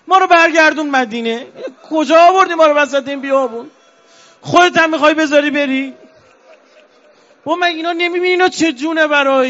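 high, loud grieving plea; wailing lament. Acted. Preacher voicing Sakina begging her father not to leave, wailing. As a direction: cry it out at full force, pleading.

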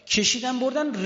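high, loud mourning wail; lament cry. Acted. Preacher crying out Ali was dragged away, loud wail. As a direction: cry the words out.

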